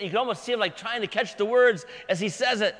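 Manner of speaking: ordinary delivery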